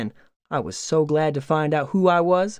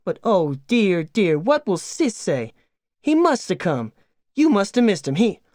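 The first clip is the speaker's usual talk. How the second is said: higher, anxious wife protesting; hammed-up worry, voice climbing; fretting farm wife; 5 semitones higher than usual. Wife feigning alarm to her husband, higher and fretful.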